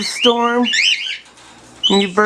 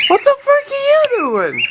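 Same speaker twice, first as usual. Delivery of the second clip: much higher, startled cry; startled outburst. Real, not acted. Owner startled as the bird does something odd. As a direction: jump up in pitch in shock. Bird suddenly acts strange.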